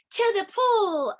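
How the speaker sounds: high, slow mock yell. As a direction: call out the destination, slowly. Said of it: "I yelled".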